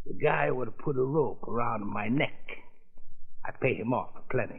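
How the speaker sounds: put-on thick foreign-accented gangster, taunting; menacing mobster in broken English; lightly accented mob boss toying with the judge.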